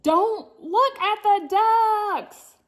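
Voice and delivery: high, slow, loud warning; high, slow, loud dramatic warning to Hector. Acted. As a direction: sound the alarm in a high, drawn-out voice. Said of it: "Hector was warned".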